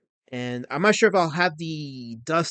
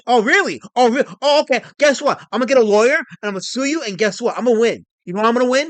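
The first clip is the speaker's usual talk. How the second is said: higher, louder challenge; worked-up retort with raised pitch; indignant threat; 4 semitones higher, 5 dB louder than usual. Acted. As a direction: fire back in heated defiance. Rant threatening to sue chatter.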